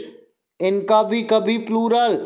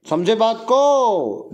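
slow check-in question; much slower than usual. Shown as a demonstration. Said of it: "teacher checking understanding".

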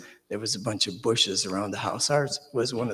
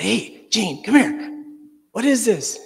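higher, louder call across the yard; 10 semitones higher, 7 dB louder, slower than usual. Retold or imitated. Yelling to brother Gene about the snake, a high loud call. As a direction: shout for your brother to come look.

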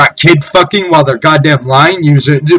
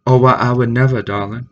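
a lightly put-on, softer Southern belle; softer mock Southern belle, darling drops away; mock Southern lady; 3 semitones lower, 6 dB quieter, faster than usual. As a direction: simper it like a prim Southern lady.

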